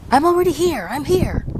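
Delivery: high, loud exasperated "what, what, what"; imitating an annoyed caller. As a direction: mimic an annoyed man rapidly cutting off a caller. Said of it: "he says".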